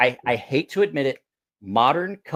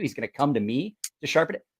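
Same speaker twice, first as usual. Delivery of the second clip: ordinary talk, nothing marked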